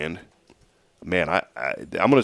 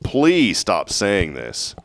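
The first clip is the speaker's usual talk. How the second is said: usual speaking voice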